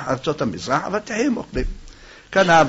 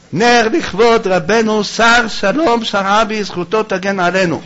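slow, raised dedication call; auctioneer's announcement; 5 semitones higher, 3 dB louder than usual. Real, not acted. Rabbi offers a candle in honour of the sage, slow ceremonial announcement. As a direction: proclaim it to the congregation.

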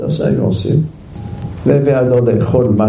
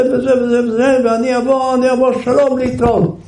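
high, hurried, irritated reading of the letter; impatient reader; 11 semitones higher than usual. Retold or imitated. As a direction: rattle off the letter like an annoyed stranger. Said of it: "היה עצבני".

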